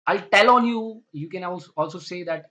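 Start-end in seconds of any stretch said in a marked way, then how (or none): 0.1-1.0 threat. Acted. Acted threat, much louder.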